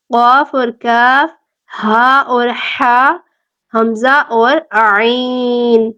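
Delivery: each letter name drawn out and clear; slow, deliberate letter list; slow careful recital. Shown as a demonstration. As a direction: read the letter pairs slowly for drilling. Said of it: "ان کو آپ نے اچھے سے دیکھ لینا ہے".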